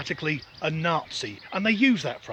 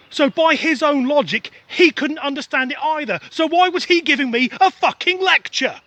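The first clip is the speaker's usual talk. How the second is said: much higher and louder, building outrage; indignant rant; 9 semitones higher, 8 dB louder than usual. Acted. Ranting about the guy lecturing him, getting louder. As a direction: let the frustration boil over.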